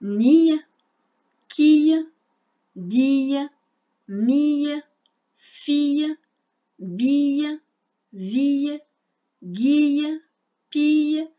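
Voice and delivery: syllabes détachées, articulées fort; lecture modèle. Shown as a demonstration. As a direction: lis chaque syllabe clairement. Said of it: "c'est à mon tour". (syllabes lues haut et fort pour l'élève)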